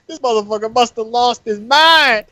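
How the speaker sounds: high, incredulous outburst; retold onlooker's amazed exclamation, pitched high; mock disbelief. Friends riffing on a ki blast, mock disbelief. Retelling an onlooker's shocked reaction.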